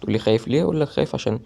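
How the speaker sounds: ordinary delivery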